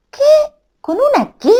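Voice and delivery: high startled question; surprised king. Acted. King baffled by the fairy's letter: high startled question. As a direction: sound baffled, pitch up.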